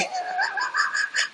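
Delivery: high squealing laugh. Real, not acted. Remembering the store line, high squealing laugh. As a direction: burst into a rising laugh.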